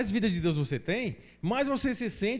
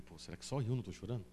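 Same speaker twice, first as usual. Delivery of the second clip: softer, quick self-doubting mumble; worried muttering to himself; 8 semitones lower, 8 dB quieter, faster than usual. Retold or imitated. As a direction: ask yourself quietly, as if ashamed. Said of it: "Eu falei".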